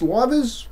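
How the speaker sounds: "livres" with a long i, LIE-verz; guessed pronunciation. Real, not acted. Vlogger trying French currency name, "livres" as LIE-verz. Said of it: "however you say that".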